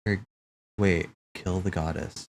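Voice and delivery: ordinary talk, nothing marked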